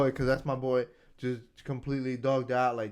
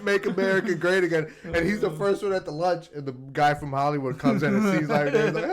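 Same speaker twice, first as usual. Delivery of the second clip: ordinary talk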